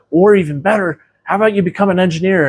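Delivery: high, fast eager pitch; excited pitch. Retold or imitated. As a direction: sell the idea, eager and quick. Dad pitching bigger career ideas.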